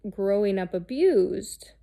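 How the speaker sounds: ordinary delivery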